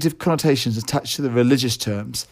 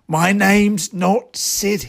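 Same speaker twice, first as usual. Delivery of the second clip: hissed; 7 semitones higher, much slower than usual. Retold or imitated. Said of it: "he hissed".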